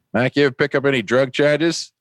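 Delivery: high-pitched South Boston cop questioning; South Boston accent. Retold or imitated. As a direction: imitate a South Boston police sergeant. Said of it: "South Boston, right down to his toes".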